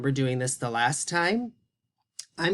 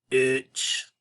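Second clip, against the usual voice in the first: moose puppet blending. Pretend. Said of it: "What am I saying?".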